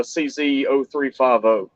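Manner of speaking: ordinary delivery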